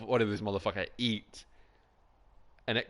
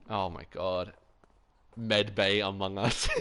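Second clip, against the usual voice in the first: usual speaking voice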